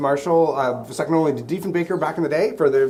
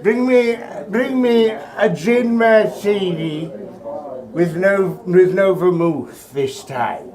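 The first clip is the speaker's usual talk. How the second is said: hoity-toity grandma; much slower than usual. Retold or imitated. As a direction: drag out the order like a grand dame. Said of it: "She's like".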